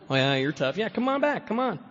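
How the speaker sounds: hammed-up tough-guy dare; mock taunt. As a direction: goad the driver to stop and fight. Taunting passing cars.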